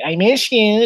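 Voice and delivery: hammed-up high girlfriend voice. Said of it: "girl that sounds like that".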